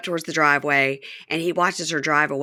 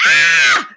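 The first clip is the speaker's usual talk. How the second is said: loud frustrated cry; mock wail; 8 dB louder than usual. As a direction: cap the imitated tirade with a loud wail. End of imitated tearful tirade.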